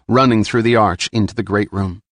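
ordinary delivery, nothing marked

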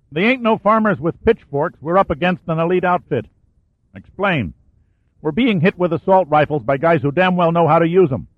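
high, urgent radio report under fire; tense soldier on radio. Acted. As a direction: push it out fast and strained.

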